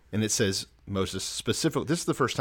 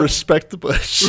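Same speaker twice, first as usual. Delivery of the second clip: mock solemn; 7 semitones higher, 10 dB louder, slower than usual. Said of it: "joking chant".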